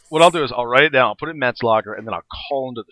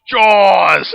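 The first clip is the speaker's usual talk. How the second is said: shark yelling its name; 6 semitones higher, 8 dB louder than usual. Retold or imitated. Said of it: "flies in from off camera and goes".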